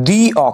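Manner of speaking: the said "dee" before ox; long "ee" in the, then ox. Shown as a demonstration. Teacher contrasts a vowel example, the as "dee". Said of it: "তাহলে কি হবে".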